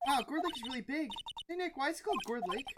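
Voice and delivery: high, eager sidekick voice; Maya, curious. Acted. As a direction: pitch it up, wide-eyed and curious.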